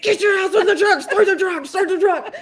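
high-pitched mock panic, half laughing; imitated urgent shouting; frantic orders. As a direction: repeat the order in a frenzy. Retelling frantic orders at truck.

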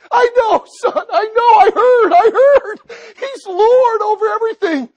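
high joyful shout, laughing through tears; overjoyed father. Acted. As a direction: cry out the good news, laughing and weeping. Father exults to son.

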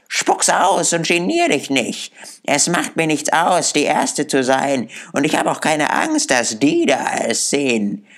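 put-on high-pitched defiant old charwoman; lightly voiced, brazen and challenging; a hint of a coarse, scornful old woman; hostile charwoman. A narrator's reading.

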